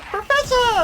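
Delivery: put-on high, piercing kid cry of Professor; obnoxious Luke. Luke calling out to his professor, high squeal. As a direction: squeal it like an annoying boy sidekick. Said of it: "they make Luke just the most obnoxious thing".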